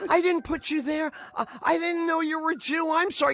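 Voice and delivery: high-pitched apologetic woman; high, pleading mock-female voice; audience lady. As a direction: imitate a flustered lady pleading innocence. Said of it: "the ladies in the front row".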